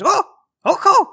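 high-pitched startled alien squeak; little alien woman. Acted. Alien woman surprised in her underwear, high squeaky babble. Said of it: "She turns around and goes".

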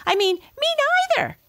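very high, squeaky little voice; tiny mouse voice. Acted. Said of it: "said a tiny little voice".

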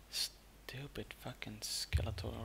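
slow whispered curse; angry whisper. Real, not acted. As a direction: hiss a curse under breath. Cursing quietly at game enemy.

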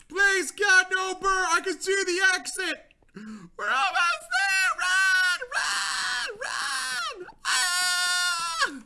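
very high frantic shouting into a scream; overexcited panic. Real, not acted. Player racing for the exit from birds, overexcited shrieking panic. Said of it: "I got really excited".

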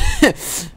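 a sudden high laugh at his own blunder; high burst of laughter. Real, not acted. Gamer laughing at his own blunder, high laugh.

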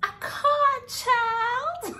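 screamed high and loud with joy; joyful scream. Real, not acted. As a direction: shriek the news out. Announcing big news.

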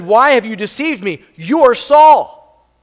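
rising to a loud, high, drawn-out "You are Saul"; woman's loud cry. Acted. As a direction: cry it out as the frightened medium, stretching the last words. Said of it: "She cries with a loud voice".

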